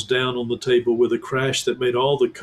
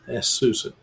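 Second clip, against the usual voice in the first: ordinary delivery, nothing marked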